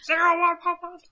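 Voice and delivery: high, loud robot number chant. Pretend. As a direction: shout binary like a computer. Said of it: "shout zeros and ones at each other".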